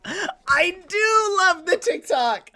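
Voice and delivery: high, slow squeaky cute voice; cute anime streamer. Acted. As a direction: coo it up high and sweet. Acting cute reply to viewer.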